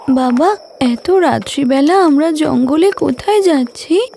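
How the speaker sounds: put-on sleepy little girl asking her father where they're going. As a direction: play the frightened five-year-old daughter. Child daughter asks father.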